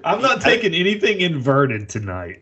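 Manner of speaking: high-pitched, laughing protest; amused imitation of a fussy veteran; mock refusal. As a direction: put on a high voice of comic indignation. Co-host mock-voices the veteran's refusal.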